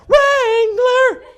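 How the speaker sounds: very high, loud squeal; swooning girls. Retold or imitated. Girls in the commercial swooning at a cowboy, high squeal. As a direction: squeal it like an excited crowd of girls. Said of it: "Bunch of girls in the back".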